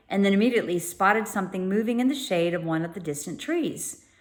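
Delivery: usual speaking voice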